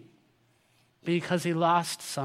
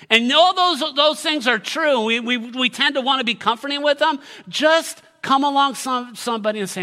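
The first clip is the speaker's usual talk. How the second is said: loud, high, urgent sermon; fervent, raised-voice appeal; impassioned preaching; 10 semitones higher, 9 dB louder than usual. Real, not acted. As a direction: preach it with full force. Preaches at full force.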